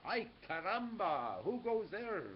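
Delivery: excited character voice; loud, slow startled call. A narrator's reading. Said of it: "asked Skippy Tom Jones".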